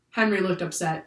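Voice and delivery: usual speaking voice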